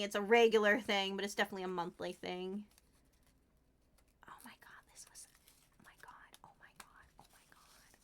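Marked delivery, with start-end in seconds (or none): 4.3-8.0 real, whispered oh my God, barely voiced; moved almost to tears, whispering; overwhelmed whisper. Real, not acted. Streamer overwhelmed by her snowflake, tearful whisper. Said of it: "I don't mean to be so emotional".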